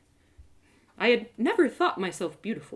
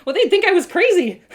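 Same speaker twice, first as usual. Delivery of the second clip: laughing, higher and louder; 7 semitones higher, 8 dB louder than usual. Real, not acted. Player cracking up at talking to a rock, laughing. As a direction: giggle through the words.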